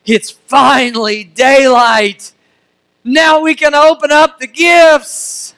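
high, slow, loud, eager; a kid who can finally open presents; eager child. Retold or imitated. Child's voice awaiting Christmas gifts.

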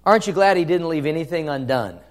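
ordinary talk

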